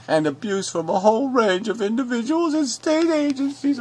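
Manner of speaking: mock him as a sniveling whiner; mocking whiny voice. Rereading the commenter's post in a mocking whiny voice. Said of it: "now you sound like a little whining bitch".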